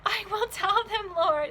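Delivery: high, loud and breathless with joy; Mary eagerly answers the risen Jesus; eager promise. Acted. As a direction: promise it in an excited rush. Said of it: "she said".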